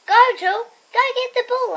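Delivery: high, loud call of "Go, Jill"; yell. A narrator's reading. Girl yelling at the puppy to fetch, loud call. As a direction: shout across the yard at the puppy. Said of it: "I yell".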